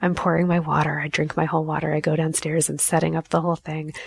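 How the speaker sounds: ordinary delivery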